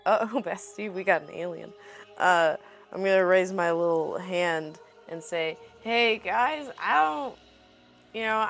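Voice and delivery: ordinary talk, nothing marked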